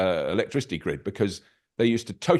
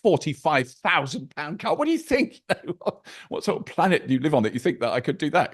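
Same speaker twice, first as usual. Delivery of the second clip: incredulous retort; 7 semitones higher than usual. Retold or imitated. Retelling telling off people who say it's all right for you, incredulous. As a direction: throw the question back in utter disbelief. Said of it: "I said".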